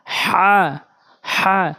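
হা pressed hard from the middle of the throat; a strong throaty হা. Shown as a demonstration. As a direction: push হা out firmly from mid-throat. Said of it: "একদম চাপ দিয়ে হলকের মাঝখান থেকে উচ্চারণ করবেন".

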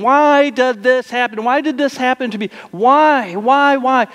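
high pleading cry of why; anguished why. Retold or imitated. Grieving believer asks God why, high and pleading. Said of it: "we ask the question".

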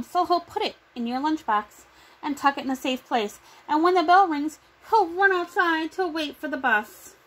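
ordinary delivery

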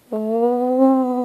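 soft eerie ghostly ooh; spooky ooh. Acted.

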